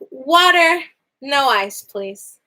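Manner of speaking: usual speaking voice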